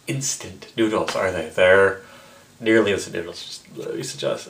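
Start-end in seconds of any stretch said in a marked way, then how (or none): 3.4-4.5 under-the-breath aside; breathy whisper to herself. Real, not acted. Aside while checking noodles.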